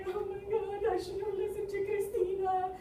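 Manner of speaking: high, mock-wailing regret; crying. Drunk crawling home regretting it, high acted crying. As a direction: whine it out tearfully. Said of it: "cries all, all the way".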